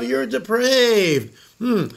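slow drawn-out high judging voice; mock accuser. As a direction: drag it out like a scolding preacher.

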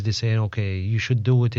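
ordinary delivery

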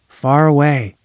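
ordinary delivery, nothing marked

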